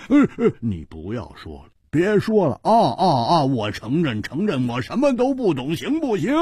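急忙讨饶，声音抬高变响; 连声认输，求对方别说了; 举手投降. Acted. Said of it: "又高举着双手说". (胡铁花举手向姬冰燕急着认输)

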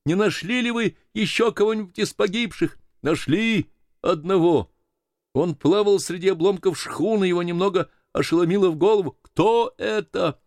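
high, projected exchange; calling across water. Acted. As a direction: raise the pitch to carry between boats. Shouted exchange between boats in fog. (высоким голосом перекликаясь издали)

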